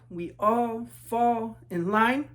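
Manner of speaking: slow, even, marching chant; slow refrain. A narrator's reading. Refrain of the marching class, slow chant. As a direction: drag it out in step like a marching line.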